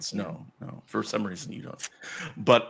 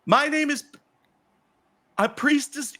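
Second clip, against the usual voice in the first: very high, chirpy greeter voice; high-pitched, over-keen hostess; excited priestess. Acted. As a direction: play an eager priestess welcoming guests. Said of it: "you are approached by the priestess".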